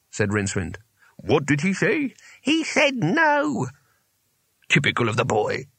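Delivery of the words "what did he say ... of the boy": put-on high, drawn-out old wizard voice; querulous old wizards. A narrator's reading. Old wizards conferring on Rincewind's answer, querulous. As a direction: repeat it slowly, then grumble.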